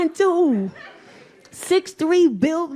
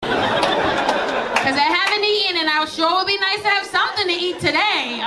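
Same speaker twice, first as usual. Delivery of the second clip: daughter's whiny voice; 6 semitones higher than usual. Retold or imitated. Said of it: "Why would you say that shit".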